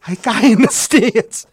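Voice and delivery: slow, high, loud disbelief; incredulous outburst. Retold or imitated. Umpire learning a fan yelled balk, acted astonishment. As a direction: repeat it as an appalled shriek. Umpire's shocked repeat of the answer.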